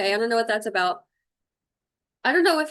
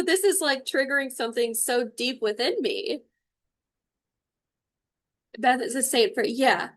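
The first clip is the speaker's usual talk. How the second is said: normal talking voice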